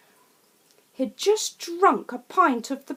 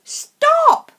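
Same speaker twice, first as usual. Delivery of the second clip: very high, loud stop; yell; 10 dB louder than usual. Acted. Family yelling at dad up the tree, alarmed. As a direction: scream it at dad. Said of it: "we yelled".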